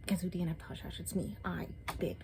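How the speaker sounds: hushed admission of giving up on the book; whispered confession. Real, not acted. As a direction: sly whisper. Confessing she dropped the book.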